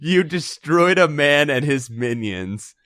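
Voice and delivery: slow, mock-grave reading; mock solemn. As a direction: savour it with mock gravity. Mock-solemn repeat of text.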